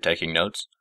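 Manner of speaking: ordinary delivery, nothing marked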